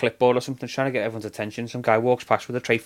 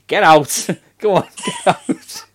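1 laughing get out; 6 semitones higher, 5 dB louder, slower than usual. Retold or imitated. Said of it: "And he goes".

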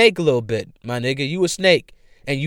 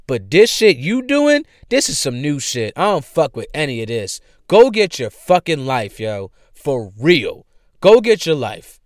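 ordinary talk

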